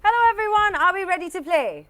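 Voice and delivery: high, loud hello to the room; excited host greeting. Acted. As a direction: pump up the crowd. Host greets contestants loudly.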